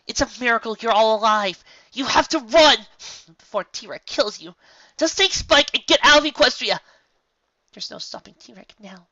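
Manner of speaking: high, loud desperate plea; desperate urging. A narrator's reading. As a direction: Twilight begs them to run. Twilight urges them flee.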